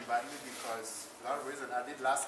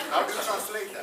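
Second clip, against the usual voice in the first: asks through a real laugh, higher and louder; 5 semitones higher, 3 dB louder than usual. Real, not acted. Actor laughing at the absurdity of French dubbing. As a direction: laugh out the question in disbelief.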